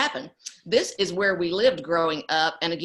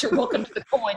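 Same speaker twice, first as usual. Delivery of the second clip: laughing; 6 semitones higher than usual. Retold or imitated. Mom quoting to robber, laughs.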